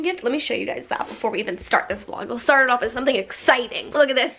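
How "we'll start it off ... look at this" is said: usual speaking voice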